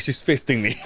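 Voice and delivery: repeated through laughter; laughing. Retold or imitated. Friend repeats patient's line, laughing at it. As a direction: say it again, cracking up.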